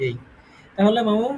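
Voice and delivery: ordinary talk, nothing marked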